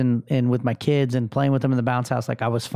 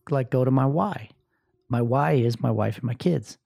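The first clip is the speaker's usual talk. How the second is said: usual speaking voice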